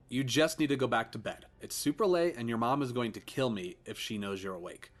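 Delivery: usual speaking voice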